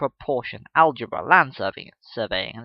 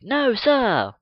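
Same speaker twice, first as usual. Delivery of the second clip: pupils' chorus; 9 semitones higher, 7 dB louder than usual. Acted. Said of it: "the chorus of".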